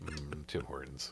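craving whisper. Pretend. Cohost dreaming of Tim Hortons, slow longing whisper. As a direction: breathe it like you can taste the coffee.

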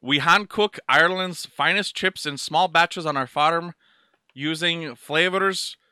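put-on lilting Irish accent. Man reads a chip packet aloud in mock Irish. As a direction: read it in a hammed-up Irish brogue. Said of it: "let me read it in Irish".

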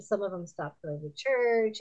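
ordinary delivery

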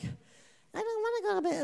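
put-on high whining kid's voice; mock little kid protesting bedtime; whiny child. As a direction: whine it like a tired child. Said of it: "it was just like".

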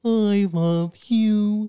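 very slow, high sung character voice; Barney. Acted. Doing a requested impression for the cohost, sung dinosaur voice. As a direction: croon it like a children's TV dinosaur. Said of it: "Can you do an impression of Barney?".

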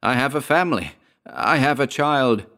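high, desperate pleading; beseeching. A narrator's reading. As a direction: beg for mercy, drawing it out at the end. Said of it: "he beseeched again".

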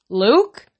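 mother calling for her son. A narrator's reading. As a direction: call out a questioning name. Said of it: "Mika Stellan called".

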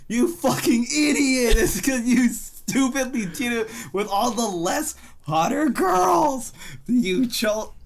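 high, loud, slow exasperated yell; furious rant. Retold or imitated. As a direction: tear into the idiot hero. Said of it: "I was just like".

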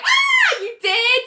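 very high, squealed wail; excited wail. Retold or imitated. Annie wailing as she runs from her office, excited wail. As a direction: imitate an overjoyed wail. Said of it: "Annie wails from her office".

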